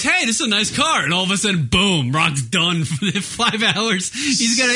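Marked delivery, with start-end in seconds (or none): 0.0-1.0 cheerful. Retold or imitated. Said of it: "Rock gets in a car and goes".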